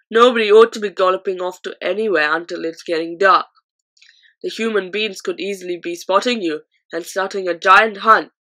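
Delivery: loud, bullying giant interrogation; booming giant. A narrator's reading. Flesh Lump Eater interrogating the BFG, loud booming giant. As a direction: lean over him and boom the questions. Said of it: "boomed the Flesh Lump Eater".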